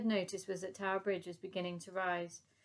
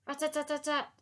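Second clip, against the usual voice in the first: high rattling burst; machine gun rattle; 8 semitones higher, 4 dB louder than usual. A narrator's reading.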